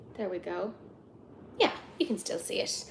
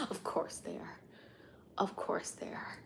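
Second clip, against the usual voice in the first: much softer, whispered wry aside; 3 semitones lower, 15 dB quieter than usual. Real, not acted. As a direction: mutter it under your breath. Wry aside about the cats.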